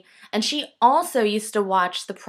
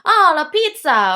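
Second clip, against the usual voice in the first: higher, louder mock Italian accent; 7 semitones higher, 6 dB louder than usual. Naming the game in a mock Italian accent. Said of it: "stereotypical, um, Italian accent".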